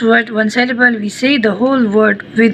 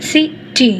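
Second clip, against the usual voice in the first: city broken into CI...ty, the CI higher and stressed; stress on first syllable; 4 semitones higher than usual. Shown as a demonstration. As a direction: stress the CI of city, then drop onto TY. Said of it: "write the word like this".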